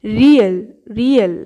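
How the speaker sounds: রিয়েল said slowly and clearly as a Korean letter name; Korean উচ্চারণে রিয়েল; Korean rieul demo. Shown as a demonstration. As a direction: spell out রিয়েল carefully, not like রিউল. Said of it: "এর উচ্চারণটা কিন্তু একদম রিউল হচ্ছে না".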